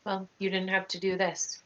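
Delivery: ordinary talk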